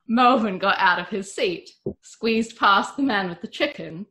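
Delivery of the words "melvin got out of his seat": plain reading voice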